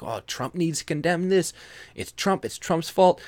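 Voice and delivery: high mocking whine, mimicking critics; mocking the left. Vlogger mocking left-wing critics, in a high mock whine. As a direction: mimic the media blaming Trump, high and whiny. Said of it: "It's like".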